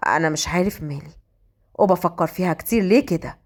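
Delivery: low male voice, questioning himself; brooding man. A narrator's reading. Fahd wonders why she fills his thoughts, low and brooding. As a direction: pitch well down for a puzzled inner monologue.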